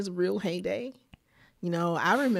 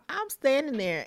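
ordinary delivery

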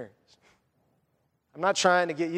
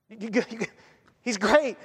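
ordinary talk

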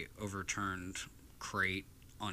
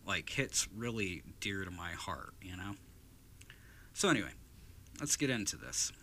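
ordinary talk

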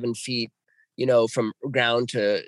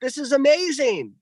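high excited pleading; reenacted excitement at the lights; thrilled; 12 semitones higher, slower than usual. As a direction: call her back in wonder. Calling wife back, thrilled.

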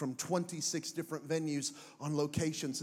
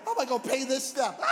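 laughing anxious. Pretend.

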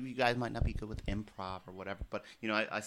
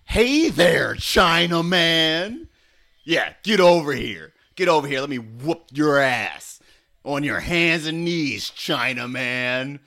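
louder, slow drawn-out Chinaman; loud bully taunt; 4 dB louder than usual. Acted. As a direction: bark a jeering challenge. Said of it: "you have to kind of antagonize him".

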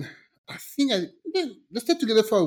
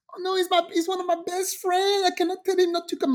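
high, apologetic whine; mock-pleading, hurried at the end; imitating pleading cousin; 10 semitones higher than usual. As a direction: make excuses in a put-on high voice. Mimics cousin making excuses.

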